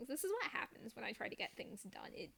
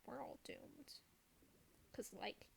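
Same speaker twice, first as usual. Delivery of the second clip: whisper; 13 dB quieter, slower than usual. Pretend. Hushed joke about waking children.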